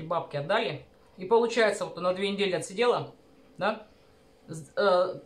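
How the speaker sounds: words muffled by food; talking while chewing. Real, not acted. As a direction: mouth partly full of food. Seen 1: Took a spoonful at -1.0; jaw and cheeks working while talking early.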